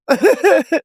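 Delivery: a high, bubbly little giggle; shy giggle. Real, not acted. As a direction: laugh it off lightly, a bit shy. Laughing after shy answer.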